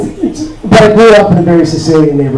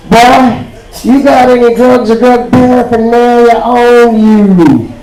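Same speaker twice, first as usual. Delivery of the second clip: higher, slow, drawn-out officer's demand; burly border guard; 4 semitones higher, slower than usual. Acted. Burly guard drilling a traveller with questions, slow and imposing. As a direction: stretch it out like an interrogating guard. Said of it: "big burly guy with an assault rifle".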